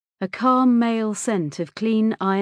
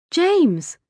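higher, louder surprised exclamation; startled recognition; 10 semitones higher, 5 dB louder than usual. A narrator's reading. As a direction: exclaim the boy's name in surprise. Startled recognition of the boy at the door.

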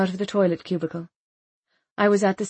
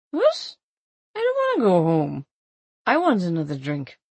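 put-on drunken indignant protest. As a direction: refuse to leave the party. Drunk protesting being taken home.

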